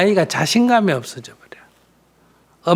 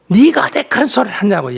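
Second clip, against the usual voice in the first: higher, louder indignant telling-off; scolding outburst; 6 semitones higher, 5 dB louder than usual. Shown as a demonstration. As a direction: snap at the child in indignation. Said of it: "야단치지 말라는 거지". (높고 큰 소리로 야단치듯)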